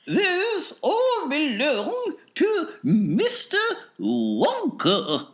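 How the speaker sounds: high, slow, indulgent mother voice. Acted. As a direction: humour the spoilt daughter slowly. Mrs Salt answers daughter.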